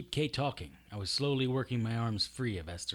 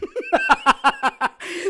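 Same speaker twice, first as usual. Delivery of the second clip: high gloating cackle; evil cackle; 10 dB louder than usual. Acted. Kay laughing at Strange's wound, high gloating cackle. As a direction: laugh at his pain.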